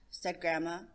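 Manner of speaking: ordinary delivery, nothing marked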